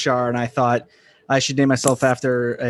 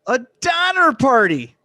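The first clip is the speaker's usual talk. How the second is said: punchline; 8 semitones higher, 8 dB louder, slower than usual. Acted. Punchline delivery.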